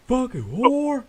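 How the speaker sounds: high, loud neighbor holler; imitated yell. Retelling the neighbor's outburst to a friend, imitated yell. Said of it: "And he yells out".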